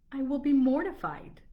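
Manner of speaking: high, loud dramatic protest; mock mortification. Lady refusing tea with her suitor, mock mortification. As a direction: cry it out in theatrical dismay.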